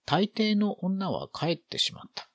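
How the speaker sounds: ordinary delivery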